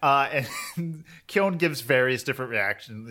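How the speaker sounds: ordinary talk, nothing marked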